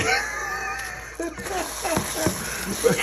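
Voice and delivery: a high, shouted burst of laughter; shriek of laughter. Real, not acted. After the phone drops into the pan, a high laughing shriek. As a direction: let out a sudden screaming laugh at the mishap.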